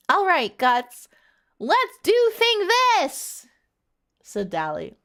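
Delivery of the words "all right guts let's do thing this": high, loud, drawn-out battle cry; rallying cry. A narrator's reading. Dally rallies the team, loud high hammed-up battle cry. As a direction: shout it slowly, hammed-up and high.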